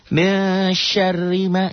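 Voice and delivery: high, chanted Arabic phrase; recitation demo. Shown as a demonstration. Teacher showing how to read it, high chant. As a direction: sing out the words like tajwid practice. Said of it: "gitu bacanya".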